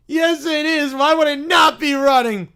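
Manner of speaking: put-on high, loud little kid indignation; indignant little bear. Bear taking fridge prank seriously, indignant. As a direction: answer the prank loudly and earnestly.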